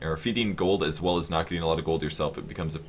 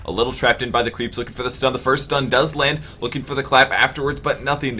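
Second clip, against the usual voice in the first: rising, louder gank call; excited call; 6 semitones higher, 6 dB louder than usual. Real, not acted. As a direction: build up the gank. Gank attempt.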